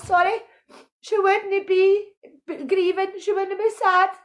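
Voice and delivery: ordinary delivery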